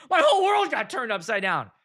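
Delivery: high, loud comic outburst; hammed-up crisis; mock distress. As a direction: wail it as if your world collapsed. Comic outrage at losing his identity.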